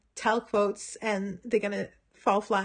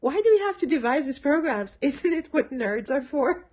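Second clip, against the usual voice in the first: complaining Cordelia; 5 semitones higher, 4 dB louder than usual. Retold or imitated. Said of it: "Cordelia is complaining about computer class".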